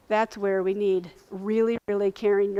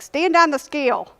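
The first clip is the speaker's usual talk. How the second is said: louder, higher curt order; super upset nurse; 7 semitones higher, 8 dB louder than usual. Retold or imitated. Nurse to crying late patient, super upset. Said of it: "they're super upset with me".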